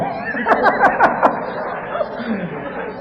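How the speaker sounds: soft run of laughter; amused laugh at your own quip; real chuckle. Real, not acted. Preacher joking about the few Mets fans, chuckling.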